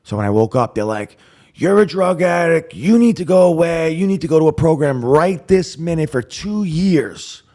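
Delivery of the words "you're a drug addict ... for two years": loud, high, forceful imitation of accusers; family's angry confrontation. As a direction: lay down a shouted ultimatum. Family confronts him in retelling.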